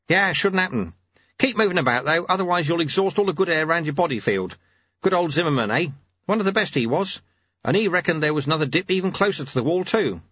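put-on easygoing old master. A narrator's reading. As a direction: play a casual mentor advising his pupil.

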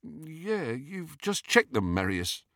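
soft, vague, starting high and sinking; slightly dazed. A narrator's reading. Confused guard to his companion, slightly dazed. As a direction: say it faintly, as if befuddled. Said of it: "in a slightly dazed voice".

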